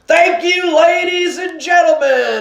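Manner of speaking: put-on man in the suit announcing the boy is found; announcing shout. A narrator's reading. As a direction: address the whole theater. Manager announcing to audience.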